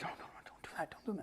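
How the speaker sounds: hushed urgent whisper. Retold or imitated. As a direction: whisper a warning so no one hears. Gavin silently warns him.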